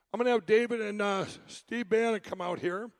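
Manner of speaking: normal talking voice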